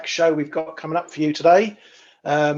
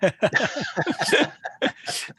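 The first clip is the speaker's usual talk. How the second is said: laughter; 12 semitones higher, 3 dB quieter than usual. Real, not acted. Laughing at joke.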